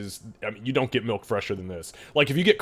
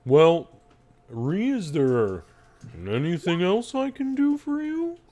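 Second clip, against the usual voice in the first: slow cow voice swooping up high; drawn-out, lowing question; talking cow; 6 semitones higher than usual. Acted. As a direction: offer help with long mooing vowels. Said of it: "so Henry says".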